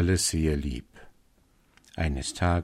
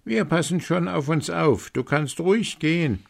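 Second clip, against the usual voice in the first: put-on high, bright kids' voice, much higher; little goats' voices; 10 semitones higher than usual. A narrator's reading. Young goats reassuring their mother, high and bright. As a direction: chirp it like eager little kids. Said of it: "Und die Geißlein sagten".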